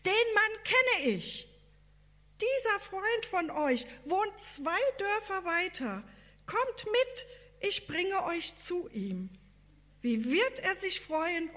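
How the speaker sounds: excited farmer. A narrator's reading. Farmer interrupts the missionaries about their friend, excited. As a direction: burst in as an excited villager. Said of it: "von einem aufgeregt gestikulierenden Bauern unterbrochen".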